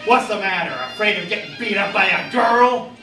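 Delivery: high, loud jeer; taunting. A narrator's reading. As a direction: goad the bully at full volume. Holly taunts Harry.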